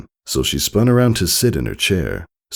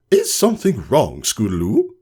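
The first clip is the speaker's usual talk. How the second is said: sarcastic; 7 semitones higher than usual. Acted. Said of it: "Her words dripped with sarcasm".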